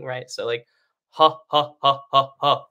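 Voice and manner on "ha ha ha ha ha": loud, punched, separate belly-laugh pulses; staged full laugh as a breath exercise; demonstrated belly laugh. Shown as a demonstration. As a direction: push out deliberate ha's from the belly, last one strongest. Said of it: "kind of a deep, full laugh".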